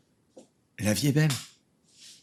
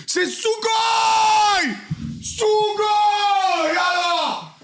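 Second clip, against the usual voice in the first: high, drawn-out yell of joy; ecstatic shout; 10 semitones higher, much slower than usual. Real, not acted. Streamer celebrating his new studio, shouting with joy. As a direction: belt it out like a victory cry.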